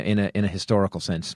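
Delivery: ordinary talk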